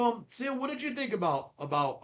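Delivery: ordinary talk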